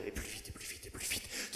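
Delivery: breathless whisper. Acted. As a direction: hiss it out, urgent. Whispered acceleration in poem.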